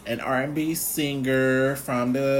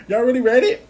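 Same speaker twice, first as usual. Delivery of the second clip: high, fast and louder with a laugh; giggly incredulous question; quick laughing "really read it?"; laughing disbelief; 11 semitones higher, 6 dB louder, faster than usual. Retold or imitated. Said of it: "So I was like".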